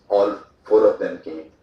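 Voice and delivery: ordinary delivery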